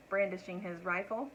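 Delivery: ordinary delivery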